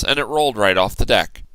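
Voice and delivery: ordinary delivery, nothing marked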